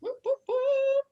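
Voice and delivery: high, slow whooping cheer; cheering whoops. Real, not acted. Friend cheering the group before the photo, high whoops.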